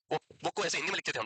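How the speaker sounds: ordinary talk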